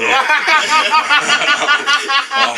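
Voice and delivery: real high-pitched laugh; high burst of laughter. Real, not acted. Laugh at helmet joke.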